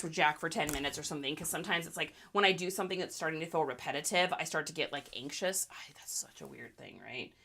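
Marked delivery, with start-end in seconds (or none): 5.7-7.3 whispering. Real, not acted. Muttered aside.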